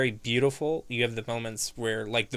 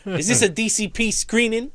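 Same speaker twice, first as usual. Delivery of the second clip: Joe Pesci impression; 10 semitones higher, 5 dB louder than usual. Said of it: "Joe Pesci".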